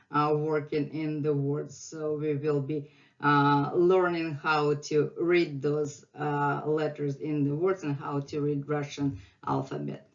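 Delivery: ordinary talk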